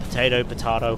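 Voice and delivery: "Potato" as po-TAY-to, then po-TAH-to; two pronunciations. Shown as a demonstration. Gamer riffing on "potato", two pronunciations. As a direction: contrast the middle vowel in each "potato". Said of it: "Progress or progress?".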